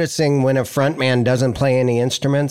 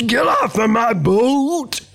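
high, drawn-out cartoon character voice; hammed-up cartoon holler, stretched out; 9 semitones higher, slower than usual. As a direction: imitate an over-affected singer as a Nickelodeon cartoon. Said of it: "recording a cartoon voice for a Nickelodeon".

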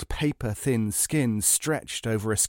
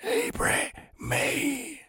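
rasping creature voice, much higher; dry rasping voice; 3 dB quieter than usual. Acted. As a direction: rasp it out like a withered ghoul. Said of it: "The dry, rasping voice".

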